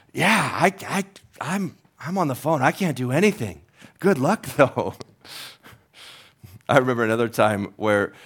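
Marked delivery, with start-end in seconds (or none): 0.1-4.9 high, flustered stammer; stammering operator, higher, laughing at the end; helpless operator. Acted. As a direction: useless dispatcher brushing it off. Acted operator shrugs helplessly.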